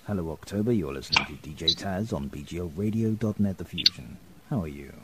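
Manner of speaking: low, soft radio announcer voice; smooth, low DJ on-air greeting. Pretend.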